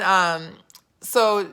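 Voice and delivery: ordinary talk, nothing marked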